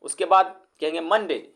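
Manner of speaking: ordinary talk, nothing marked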